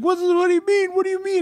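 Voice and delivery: very high, frantic mimicry; hammed-up clueless panic, high and tumbling; confused caller. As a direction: squeal it in mock bewilderment.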